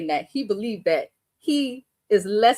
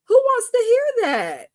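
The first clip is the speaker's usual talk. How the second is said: high, drawn-out exasperated question; slow, high-pitched indignant outcry; incredulous; 14 semitones higher, 3 dB louder, slower than usual. Real, not acted. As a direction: stretch it out in disbelief. Incredulous rhetorical question about the man.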